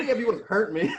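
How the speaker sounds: laughing high and loud through the quote; laughing through a cocky line. Retold or imitated. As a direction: crack up while quoting him. Retelling Tim's cocky dismissal.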